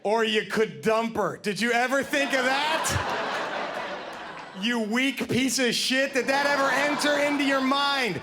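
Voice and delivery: ranting half-laughing, building into a shout; tough-love rant. Retold or imitated. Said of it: "and he goes".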